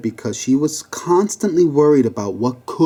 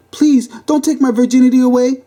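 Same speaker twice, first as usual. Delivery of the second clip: high-pitched desperate plea; pleading in a high, frantic voice; 12 semitones higher, 4 dB louder than usual. Acted. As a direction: beg the officer in a high voice. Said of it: "she pleaded".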